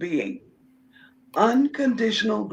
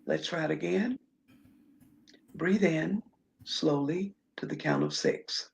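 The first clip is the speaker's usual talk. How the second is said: guided breathing. Acted. Leads breathing exercise.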